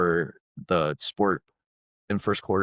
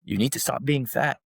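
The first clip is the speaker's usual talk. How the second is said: blunt, higher coach voice; coach's punchline in a raised voice; imitating coach; 5 semitones higher than usual. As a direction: deliver the blunt verdict like a coach. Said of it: "And he goes".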